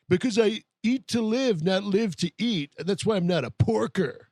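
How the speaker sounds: high, emphatic boasting impression; mock self-satisfied fitness brag. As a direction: brag like a vain gym guy. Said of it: "he's like".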